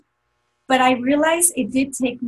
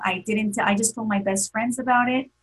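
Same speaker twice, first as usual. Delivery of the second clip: normal talking voice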